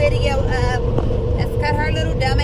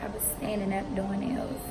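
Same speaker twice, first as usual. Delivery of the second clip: hushed talk; 2 semitones lower, 12 dB quieter than usual. Real, not acted. Waiting room, talking softly.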